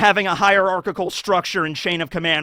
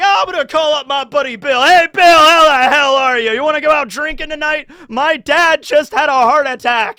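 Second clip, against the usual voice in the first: higher, louder, breezy cheer; gushing party-guy call; mock cheery caller; 9 semitones higher, 6 dB louder than usual. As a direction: ham up the clueless happy friend. Oblivious friend calling, mocked.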